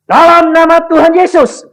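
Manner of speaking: loud, high rebuke in Jesus' name; commanding shout. Retold or imitated. Preacher shouting a rebuke at the demon.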